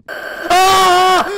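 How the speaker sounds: high, loud, frightened scream. Real, not acted. Gamer jump-scared at three in the morning, screaming. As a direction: shriek from sudden fright. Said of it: "Qué grito más varonil".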